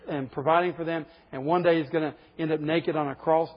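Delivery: ordinary delivery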